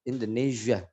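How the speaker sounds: "Indonesia" with a buzzing zh; blown zh. Shown as a demonstration. Teacher modelling English, "Indonesia" with blown zh. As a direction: blow the s of "Indonesia" as zh.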